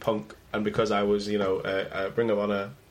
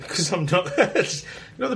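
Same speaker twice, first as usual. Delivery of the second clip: laughing; 6 semitones higher, faster than usual. Real, not acted. Laughing refusal.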